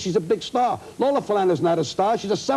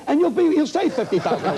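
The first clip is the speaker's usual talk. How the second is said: laughing through it; 6 semitones higher than usual. Retold or imitated. Retold retort to boss, laughing.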